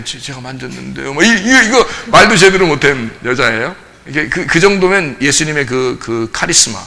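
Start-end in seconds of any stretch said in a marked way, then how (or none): 0.0-1.1 stammered, much softer confession; a hint of a scared woman stammering; trembling. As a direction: barely get it out, trembling. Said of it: "벌벌 떨면서".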